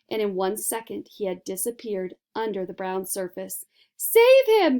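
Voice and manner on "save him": high, loud panicked scream. A narrator's reading. Mother screaming for her drowning son, panicked. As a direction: shriek it out for help. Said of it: "screamed Missus Gloop".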